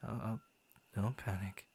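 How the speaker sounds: usual speaking voice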